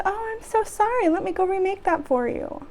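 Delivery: high-pitched customer-service sweetness; fake upbeat apology. Worker hides fury from the customer, falsely cheerful apology. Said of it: "having to pretend to be happy and upbeat".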